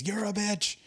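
mock sergeant yell. Sergeant berates the soldier to his face, mock yelling. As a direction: play a drill sergeant's yell without real volume. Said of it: "the sergeant yelling in my face".

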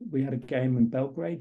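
ordinary talk, nothing marked

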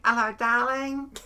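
loud, slow sleazy catcall; mock catcall. Imitating a man catcalling her in the street, loud. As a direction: yell it like a street creep. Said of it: "this guy yelled out at me".

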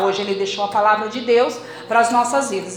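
ordinary talk

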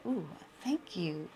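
soft, slow, put-on sweet thank you; fake polite thanks. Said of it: "and I said".

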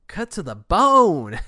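loud, high, drawn-out exclamation; mock wounded cry. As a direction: stretch it out as a comic cry of hurt. Mock reaction to a cutting insult.

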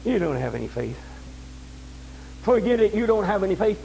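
a hint of the devil sneering at the faithful; mock devil voice. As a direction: whisper doubt like the devil. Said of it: "devil to come along and say".

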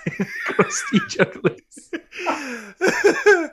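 slow, high, breaking up with laughter; helpless real laughing. Real, not acted. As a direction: struggle to get the words out through laughter. Cracks up at crusty jugglers joke.

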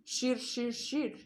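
screechy grinding sound effect; imitated knife-grinding noise. A narrator's reading. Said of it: "valami surrogó, sikkantó zaj".

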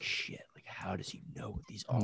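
retold thought in a near whisper; hushed, whispery mock disbelief; whispered aside. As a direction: barely voice it, as if stunned to yourself. Retold stunned reaction to cursing kid.

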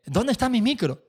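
lightly acted anxious query; mock calling out. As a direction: call the question out to someone across the room. Bandmate asks over the mic.

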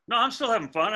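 put-on high, loud, bright kid voice; eager kid reply. Young pitcher assuring his coach on the mound, high eager voice. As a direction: pipe up, insisting he's fine.

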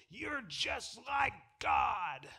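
slow, drawn-out, menacing mock voice; mock spooky clip voice. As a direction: ham up a sinister doctored clip. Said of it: "they jazz it up".

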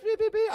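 high, loud electronic beeps; imitated detector beeps. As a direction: sound like a detector going off. Detector going off over nice trips.

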